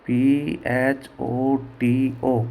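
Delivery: very slow, letter by letter; each letter held apart; spelling out. Shown as a demonstration. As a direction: spell it out slowly for a class. Teacher spells the word out.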